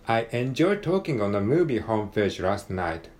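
linked, natural English with swinging intonation; model-CD English accent. Shown as a demonstration. Learner rereading the page as close as possible to model-CD English. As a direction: imitate the English textbook CD's accent. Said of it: "なるべくお手本に近い読み方で".

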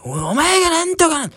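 put-on a drunk picking a fight; mock quarrel shout. (喧嘩腰の叫びの真似)

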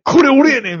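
high, loud showing-off voice; mock proud reveal. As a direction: shout it out like proudly showing off a video. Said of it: "みたいな". (「これ俺やねん」と得意げに高く叫ぶ)

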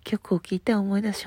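usual speaking voice

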